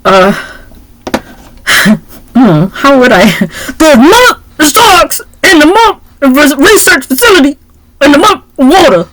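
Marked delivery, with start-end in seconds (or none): 3.8-9.1 a hammed-up, laughing, high impression of a tough actor; Samuel L. Jackson impression. Asked to do Samuel L. Jackson, a laughing mock impression. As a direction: try to sound like Samuel L. Jackson and crack up. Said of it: "you should have did it in his voice".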